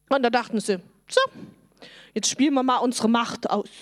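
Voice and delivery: normal talking voice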